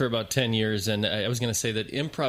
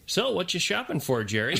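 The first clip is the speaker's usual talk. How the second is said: higher, cheerful off-track question; clueless improviser; 7 semitones higher than usual. Retold or imitated. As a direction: ask blithely, missing the scene. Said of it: "he comes out".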